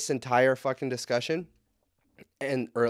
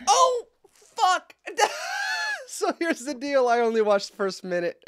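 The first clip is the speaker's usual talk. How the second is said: cringe laughter; 5 dB louder, slower than usual. Real, not acted. Cringing at the letter reading.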